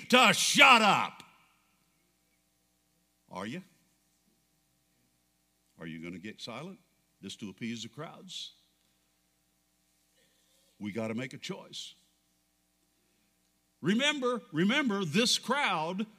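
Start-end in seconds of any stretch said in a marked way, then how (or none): 0.1-1.2 slow, loud, high shout; preacher's shout. Acted. Preacher shouting the world's command to shut up. 3.3-11.9 much lower and softer, quick question; hushed challenge. Acted. Preacher challenging the church quietly after the shout.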